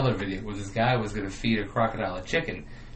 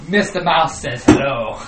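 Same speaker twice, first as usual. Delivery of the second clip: menacing hitman; 4 semitones higher, 9 dB louder, slower than usual. Pretend. Kangaroo voiced as menacing hitman.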